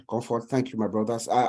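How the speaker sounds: usual speaking voice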